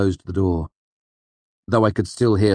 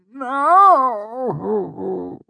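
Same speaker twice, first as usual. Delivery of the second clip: a sudden high, louder wail of despair; despairing cry; 5 dB louder than usual. Acted. Wynne refuses to leave with a hopeless cry. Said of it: "a hopeless, despairing cry".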